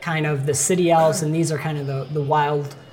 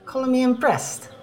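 put-on high-pitched young elf with a northern accent; cheeky wild elf boy, accented; boyish northern elf accent; 7 semitones higher than usual. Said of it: "boyish voice behind you".

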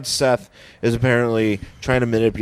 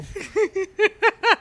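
witch cackle. Pretend.

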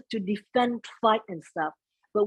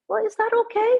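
voiced thought, pitched much higher; questioning inner thought; 12 semitones higher, 3 dB louder, faster than usual. Woman recalling her doubts about being yelled at, high questioning voice. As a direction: wonder it aloud in a high voice. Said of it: "And I'm thinking".

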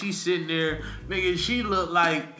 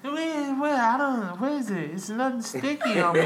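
high-pitched imitation of a confused girlfriend; 10 semitones higher than usual. Man mimicking his girlfriend, high and puzzled. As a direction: play her confused and clueless, pitch way up.

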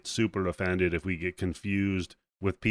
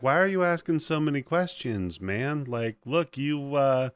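mildly annoyed deflection; 6 semitones higher than usual. Acted. Hunters deflect the hero's questions.

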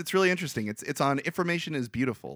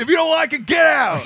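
high, loud hammed-up yokel yell; mock patriot shout; 13 semitones higher, 11 dB louder than usual. Telling critics to leave America, mock patriotic shout.

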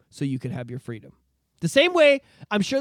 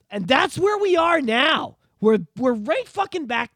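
slow, loud, raised declaration; emphatic shout; 7 semitones higher, 5 dB louder, slower than usual. Real, not acted. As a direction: drive the point home, shouting. Emphatic rant.

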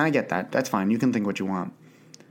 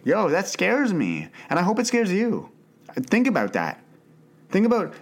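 much higher pitch, slowed and pressing; intense warning; 8 semitones higher than usual. Real, not acted. Telling listeners the thought scares him, urgent and high. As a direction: lean in and make them feel the fear.

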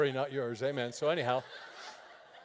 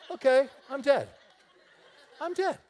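mock panic; 7 semitones higher, 7 dB louder than usual. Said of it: "I'm like".

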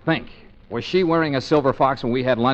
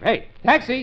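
high-pitched street call; hailing a taxi; 11 semitones higher, slower than usual. Acted. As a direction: call out to flag down a cab. Hailing a cab.

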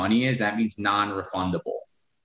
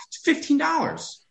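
exasperated. Real, not acted. Exasperated high retort.

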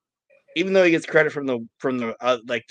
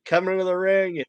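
high, raised wrestler-as-announcer intro; ring announcer; 5 semitones higher than usual. Retold or imitated. Wrestler introducing El Dandy into the ring, mock announcer. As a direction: announce him to the crowd with gusto. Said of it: "he introduces El Dandy, and he's like".

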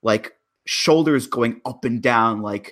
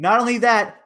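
excited; 12 semitones higher, 3 dB louder than usual. Real, not acted. Excited build in podcast rant.

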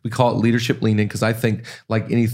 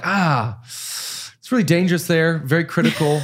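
mock wary complaint; 6 semitones higher than usual. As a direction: warn them off, half-joking. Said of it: "people don't really go like".

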